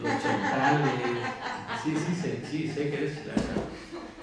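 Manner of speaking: loud laughing aside. Real, not acted. Laughing over friend's interruption.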